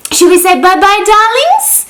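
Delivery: high squeaky bye-bye; bird voice. Real, not acted. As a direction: voice it like the parrot. Talking for her bird.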